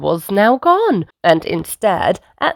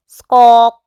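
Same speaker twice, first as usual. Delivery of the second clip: loud, raised bird squawk; parrot squawk; 4 semitones higher, 8 dB louder than usual. A narrator's reading. Parrot replying to granny, loud squawk.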